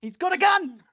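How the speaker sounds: high startled cry of alarm; alarmed shout. Acted. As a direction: blurt it in sudden panic. Alarmed player exclaims in-character.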